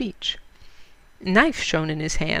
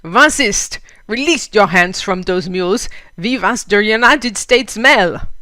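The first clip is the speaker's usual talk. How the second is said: put-on loud indignant German-accented protest; German accent, loud and slow at the end; 3 semitones higher, 9 dB louder than usual. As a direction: bellow it like an outraged German mail driver. Outraged German mail carrier.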